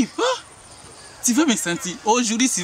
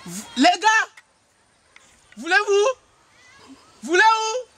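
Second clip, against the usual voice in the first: calling out; 13 semitones higher than usual. Acted. Calling out to the guys.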